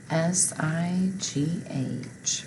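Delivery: slow letter-by-letter "S I G H"; slow spelling. Shown as a demonstration. Teacher spelling a word slowly to the class.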